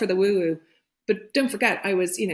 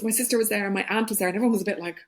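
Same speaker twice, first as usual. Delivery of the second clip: ordinary talk, nothing marked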